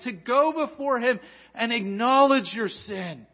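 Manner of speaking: high, loud pleading sermon; fervent preaching. Acted. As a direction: urge them to flee to Christ. Pastor urging the congregation.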